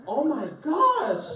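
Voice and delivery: usual speaking voice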